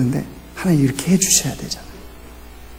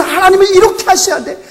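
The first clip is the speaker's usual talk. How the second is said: much higher, demanding mock believer's voice; self-righteous believer; 12 semitones higher, 4 dB louder than usual. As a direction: mimic a believer demanding things from God. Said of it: "내가 신앙생활하면서".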